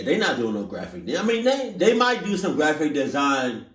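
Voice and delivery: loud, high comic rant. Pretend. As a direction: shout the punchline up high. Shouting about crocodiles while hiccuping.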